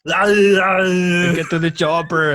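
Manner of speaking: slow, loud Arnold-style bark; Schwarzenegger impression. Friend prompts a Schwarzenegger bit, slow loud movie-hero bark. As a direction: boom it out like an action hero. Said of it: "pretty good".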